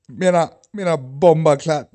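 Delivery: loud, high mock Jamaican patois; Jamaican accent. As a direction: belt out a fake Jamaican accent. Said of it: "Sounded Jamaican".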